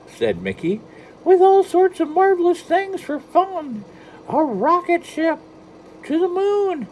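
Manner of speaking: high, loud falsetto mouse voice; bad Mickey Mouse. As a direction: sell Disneyland like an eager cartoon mouse. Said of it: "Oh, my Mickey is so bad".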